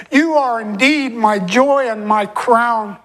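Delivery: ordinary talk, nothing marked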